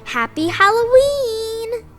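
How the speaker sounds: ordinary delivery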